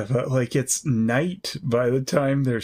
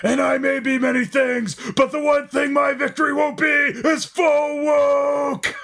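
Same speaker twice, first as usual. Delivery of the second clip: wrestling promo; 13 semitones higher, 3 dB louder than usual. Pretend.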